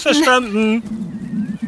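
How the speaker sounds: a slow, dragged-out mock acknowledgement; drawn-out echo. Ben echoes the gardener's order, slowly drawn out. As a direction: stretch the word out very slowly.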